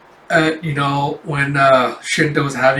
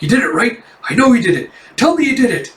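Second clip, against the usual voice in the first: raised pitch climbing higher with each plea; louder, insistent, building; eager urging; 8 semitones higher, 4 dB louder, faster than usual. Retold or imitated. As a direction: imitate an excited wife pressing for news. Wife pressing for the result.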